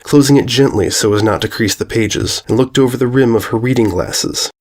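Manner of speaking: ordinary delivery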